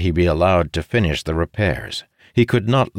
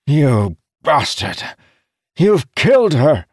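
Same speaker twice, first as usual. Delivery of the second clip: loud, raised, seething outburst; enraged accusation; 9 semitones higher, 5 dB louder than usual. A narrator's reading. Captain accusing the commander of killing his ship, enraged. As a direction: build from slow fury into a yell. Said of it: "Idara growled".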